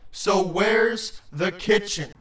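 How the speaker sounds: low, slow creature voice; deep, insistent question; looming demand. A narrator's reading. Creature looms, demanding food.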